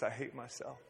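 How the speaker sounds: softly voiced confession of a woman breaking down; retold breakdown. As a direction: drop very quiet, as if barely holding back tears. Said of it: "she starts to break down".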